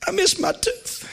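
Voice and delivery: high, slow, lightly tearful whine; mock crying. Groggy preacher whining to his wife about his tooth. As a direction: whimper it like a sad child. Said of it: "I said".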